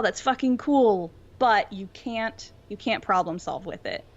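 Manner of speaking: ordinary talk, nothing marked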